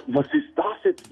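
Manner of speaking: high, laughing disbelief; mock shock, half laughing. Caller imitating his own shock at the date, laughing.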